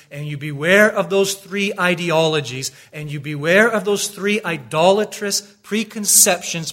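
loud, high warning sermon; full-force preaching. Acted. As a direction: thunder the warning at the disciples.

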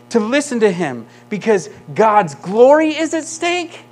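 loud, driving sermon voice; full-force preaching. Acted. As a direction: preach it out to the room.

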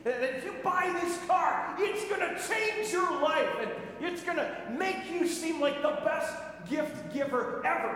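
oversell it like a TV commercial; mock car commercial; ad pitch. Mimicking car ads.

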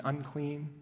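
ordinary talk

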